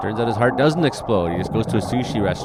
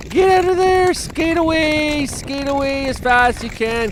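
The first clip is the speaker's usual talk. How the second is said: very high, loud screams to flee; frantic yelling; 5 dB louder than usual. Real, not acted. Yelling at the skater on the heaving ice, frantic.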